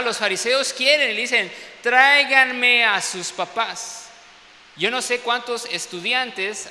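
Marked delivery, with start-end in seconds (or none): 1.8-4.1 loud, slow and commanding; stern demand. Retold or imitated. Pharisees demanding the blind man's parents, loud and slow. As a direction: bark the order at the blind man.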